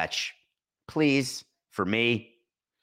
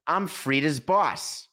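Fletch line; 7 semitones higher, slower than usual. Retold or imitated. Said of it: "And then Fletch says".

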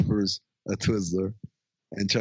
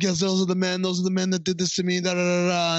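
high put-on accusation; mock accusing; 7 semitones higher, faster than usual. Chris Pine accusing the guys, high mock accusation. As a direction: point the finger in a higher voice. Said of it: "He's like".

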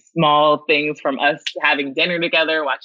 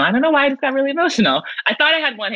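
getting emotional; 10 semitones higher, much faster than usual. Real, not acted. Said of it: "I'm about to get emotional".

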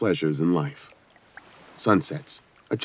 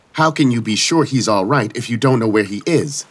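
loud demanding question; Justix voice; 5 semitones higher, 4 dB louder than usual. Acted. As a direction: push the question hard, authoritative. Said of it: "She was using her Justix voice. It sounded way too loud".

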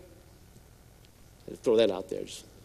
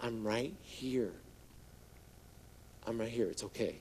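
tender reassurance; 6 semitones lower, 16 dB quieter than usual. Retold or imitated. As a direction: soothe a frightened friend gently. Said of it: "he's like".

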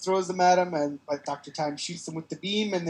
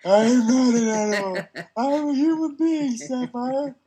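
high, slow, pleading cry; anguished plea, pitched way up; Elephant Man quote; 9 semitones higher, 5 dB louder, slower than usual. Acted. Elephant Man movie quote parody.

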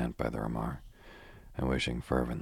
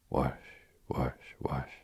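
breathy whispered whoosh sound effect; whispered whoosh of flames; slower than usual. Shown as a demonstration. Acting out the burning deck for Miss Taylor, whispered whooshes. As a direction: hiss the flames like a whooshing fire. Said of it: "I can do a bit with action".

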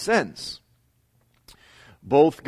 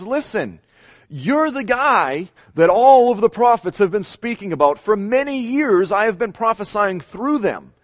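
higher, louder, commanding divine voice; voice of the Lord; 10 semitones higher, 8 dB louder than usual. Acted. The Lord directly addressing Gog, higher and louder, commanding. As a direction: speak as God declaring to Gog. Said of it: "and he says".

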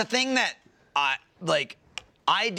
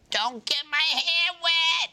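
high, drawn-out whine of complaint; mock fussing; 12 semitones higher than usual. Son mimicking what he heard growing up, mock fussing. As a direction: stretch it out high in mock protest.

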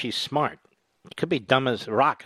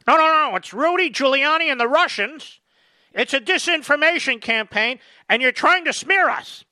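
much higher, louder defensive rant; hammed-up Biden denying everything; mock Biden; 10 semitones higher, 4 dB louder than usual. Host mocking Biden dodging the laptop question, high and loud.

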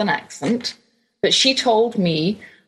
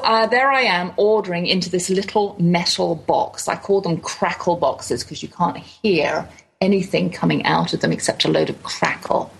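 normal talking voice

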